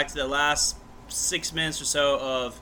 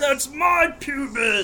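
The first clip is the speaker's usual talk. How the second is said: proud shout; 9 semitones higher, 5 dB louder, slower than usual. Pretend. Bragging quote shouted.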